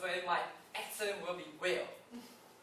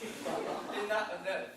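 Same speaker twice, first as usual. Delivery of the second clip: normal talking voice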